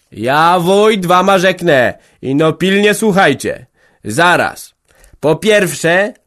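loud, high self-important speech; tipsy headman holding forth loudly; pompous address. A narrator's reading. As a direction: demand attention as the headman. Headman taking the floor.

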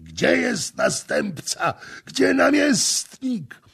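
rising, desperate bellow; slow, straining demand, climbing high; frantic shout. A narrator's reading. Intruder storming the feast for the prince, bellowing.

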